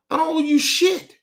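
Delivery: defiant outburst. Real, not acted. To family members who act owed, defiant outburst. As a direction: throw it right back at them.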